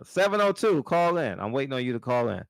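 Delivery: high and loud call to the chat; loud high hail to caller Seven oh two. Real, not acted. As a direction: shout for the caller to ring in. Calling out to absent caller.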